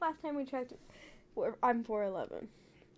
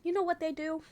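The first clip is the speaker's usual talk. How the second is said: ordinary delivery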